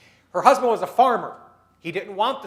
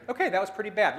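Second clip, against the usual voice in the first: ordinary talk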